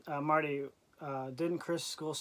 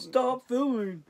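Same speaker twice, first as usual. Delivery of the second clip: high, loud, drawn-out holler; slow, stretched-out mock shout; imitated yell; 9 semitones higher, 6 dB louder, much slower than usual. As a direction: mimic an angry man yelling across a store. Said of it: "started yelling at you".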